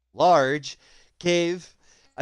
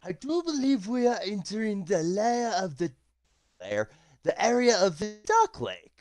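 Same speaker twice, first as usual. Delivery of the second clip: a lightly voiced character with a raised pitch; Shushar's voice; 6 semitones higher than usual. Game master voicing Shushar to the party, lightly voiced. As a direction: speak as the guide Shushar. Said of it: "he goes".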